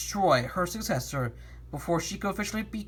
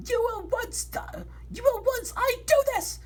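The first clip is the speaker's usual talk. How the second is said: villain voice. Acted. Villain speaks in story.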